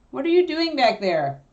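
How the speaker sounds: loud call to a noisy dog. Real, not acted. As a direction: call out to the puppy behind you. Calling out to the puppy.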